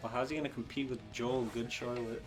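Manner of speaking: ordinary talk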